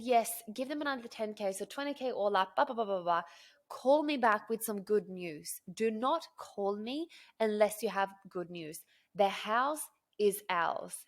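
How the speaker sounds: loud, emphatic retold orders; forceful insistence. Buyer tells agent the house is ours, forcefully insistent. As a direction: drive each demand home loudly.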